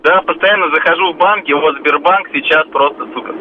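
radio caller. Real, not acted. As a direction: voice through a phone line. Caller on the radio phone line. (голос по телефону)

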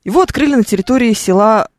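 ordinary delivery

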